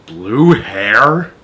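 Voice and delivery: ordinary delivery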